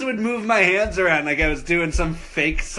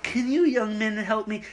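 lightly voiced lady customer, higher pitch; 6 semitones higher than usual. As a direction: imitate a customer politely asking for help. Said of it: "she goes".

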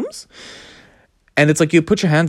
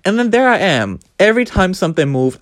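ordinary talk

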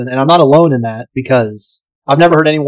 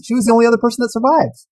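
normal talking voice

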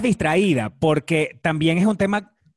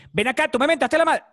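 high-pitched, acted indignation; mock confrontation; 11 semitones higher than usual. Insulted person confronting a masked stranger, mock indignant. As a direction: challenge them, voice jumping up high.

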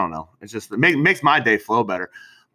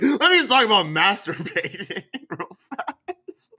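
slow, high, laughing mock of his buddy; mock quote of his buddy; 9 semitones higher, slower than usual. Teasing his cohost about his topic, slow laughing mock. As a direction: drawl it out, laughing at him. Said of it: "You're like".

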